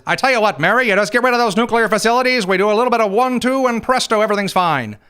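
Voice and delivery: hammed-up Witkoff coaxing Iran to give up the nukes; mock Steve Witkoff. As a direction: make the deal sound easy, like a magic trick. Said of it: "He sends Witkoff to Iran, says".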